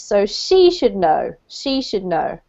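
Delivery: SHE should know with weight on she; stress on SHE. Shown as a demonstration. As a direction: hit she, not should. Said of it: "put the emphasis on the, the person".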